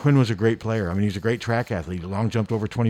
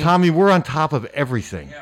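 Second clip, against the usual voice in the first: loud, raised tongue-in-cheek boast; mock-bragging, pushed up in pitch and volume; sarcastic; 4 semitones higher, 6 dB louder than usual. Real, not acted. Host to late caller about the show's news, sarcastically boastful. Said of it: "somewhat sarcastically".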